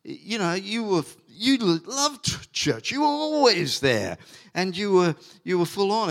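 normal talking voice

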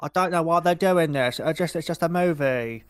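hammed-up mock Cockney. Imitating the film character's excuses, terrible Cockney. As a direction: put on a bad British accent for laughs. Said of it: "stereotypical Cockney British accent".

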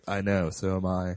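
a low murmur like a little furry creature; deep Ewok voice. Retold or imitated. Wicket answering the cold little girl, in a low soft creature voice. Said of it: "Wicket's like".

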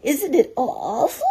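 high, loud quavering squeak; frightened Piglet. A narrator's reading. Piglet clinging to Christopher Robin, high and frightened. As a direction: wail it in a small scared voice. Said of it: "said Piglet".

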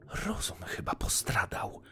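exasperated aside to oneself; grumbling to herself. A narrator's reading. Wife alone, complaining about her singing husband, grumbling to herself. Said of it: "mówiła do siebie żona".

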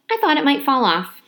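usual speaking voice

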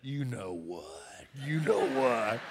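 low, hushed parrot murmur; quiet, knowing bird punchline; chastened parrot. Acted. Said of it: "And the bird said".

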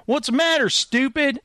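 high-pitched taunt; jeering bully. Acted. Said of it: "And he goes".

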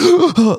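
sharp high gasp of someone jolted awake; startled gasp. Retold or imitated. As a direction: gasp like you've been shocked out of sleep. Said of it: "they're like".

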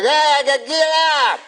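high put-on Cosby voice; Bill Cosby impression. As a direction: pitch it up like Cosby. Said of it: "And he's like".

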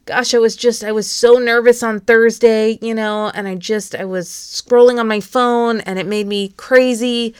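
acted overwhelmed outpouring; anxious little girl. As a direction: confess the bad week in a worked-up rush of feeling. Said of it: "She'll say".

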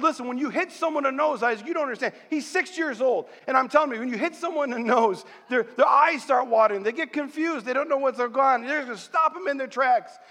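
high-pitched, carried-away fatherly pep talk; fired-up coaching, pitched high; excited retold dad. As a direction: re-enact a dad getting all worked up as he instructs his son. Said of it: "as I'm getting all excited".

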